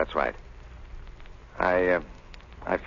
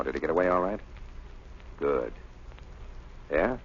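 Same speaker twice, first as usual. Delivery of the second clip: ordinary talk, nothing marked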